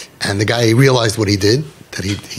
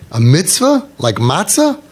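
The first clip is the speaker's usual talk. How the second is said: higher, slow, rising at the end; incredulous question; 6 semitones higher, slower than usual. Retold or imitated. Students asking the rabbi about a mitzvah, puzzled rising question. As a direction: imitate puzzled questioners, pitch climbing.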